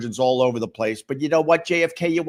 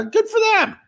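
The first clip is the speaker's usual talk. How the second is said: 1 mocking sing-song; 5 dB louder than usual. Real, not acted.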